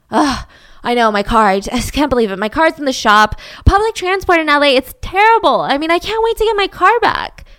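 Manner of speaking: high, loud chatty nice guy; Kenny making friendly small talk with Jane, chatty and high; charming small talk. Retold or imitated. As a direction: lay the charm on thick.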